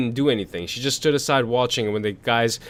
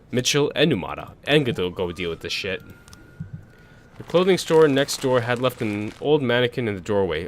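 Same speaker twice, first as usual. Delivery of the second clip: usual speaking voice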